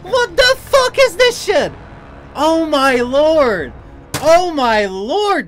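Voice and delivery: very high, loud, drawn-out outcry; utterly surprised. Real, not acted. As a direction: stretch each exclamation in real shock. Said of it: "I'm just fucking surprised".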